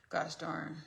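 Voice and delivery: ordinary talk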